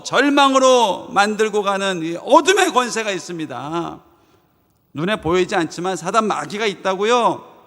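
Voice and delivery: loud, high impassioned sermon; fervent preaching. Acted. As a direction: drive it home with full fervor. Preaching at full force.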